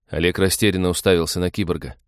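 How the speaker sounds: usual speaking voice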